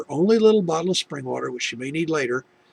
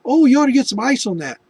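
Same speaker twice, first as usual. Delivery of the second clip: high, louder offhand advice; breezy nurse; 9 semitones higher, 8 dB louder than usual. Retold or imitated. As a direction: toss off the advice lightly. Nurse giving easy advice.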